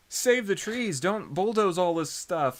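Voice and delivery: high, drawn-out mock protest; Lorax. Marco facing down the bulldozer, high mock crusading plea. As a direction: plead like an earnest eco-crusader. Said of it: "he's, like, pulling a full Lorax".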